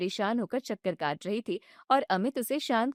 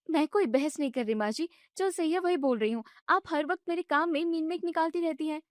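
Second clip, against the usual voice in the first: higher, indignant daughter-in-law voice; young wife protesting; 6 semitones higher than usual. Acted. Bride objects when mother-in-law shuts off the gas, indignant and high. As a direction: push back, hurt and annoyed.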